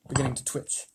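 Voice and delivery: ordinary delivery, nothing marked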